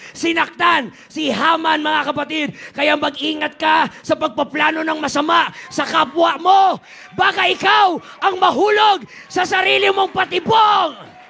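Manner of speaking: loud, high-pitched sermon climax; fiery preaching. Real, not acted. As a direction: preach it with full force. Preaching at full force. (mainit na pangangaral)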